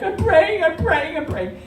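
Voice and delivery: very high and loud at first, sinking to normal; a panicked plea that winds down; a frightened passenger repeats her prayer, calming down; frantic praying fading. Retold or imitated. Panicked, tearful self on plane.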